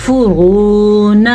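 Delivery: فرون with short "fu" and long held "roo"; slow recited فرون, heavy ra; stretched waw. Shown as a demonstration. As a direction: hold the "roo" in فرون, clip the final "na". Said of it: "فو نہیں".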